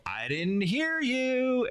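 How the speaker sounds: high, slow mock pushy emcee; cheesy demand. Mocking an emcee who makes the crowd repeat, high and slow. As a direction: drag it out like a cheesy host. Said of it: "then they go".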